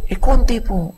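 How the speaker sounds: high, small sheepish voice; sheepish wife. Acted. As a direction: play it coy and meek. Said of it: "sumasagot ka". (mahinhing sagot ng misis)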